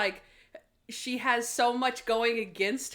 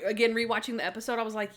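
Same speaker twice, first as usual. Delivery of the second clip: ordinary delivery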